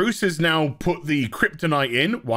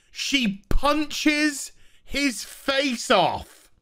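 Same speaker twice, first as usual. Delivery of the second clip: slow, higher, disbelieving; incredulous drawn-out telling; 7 semitones higher, much slower than usual. Real, not acted. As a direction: spell it out slowly as if you can't believe it. Disbelieving retelling of absurd scene.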